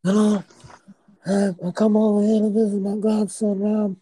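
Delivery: high, mock mob godfather voice. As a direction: play a hammy old godfather. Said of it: "Godfather Don".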